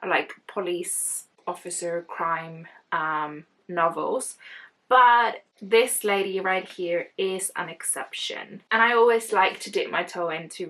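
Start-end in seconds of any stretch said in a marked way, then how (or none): none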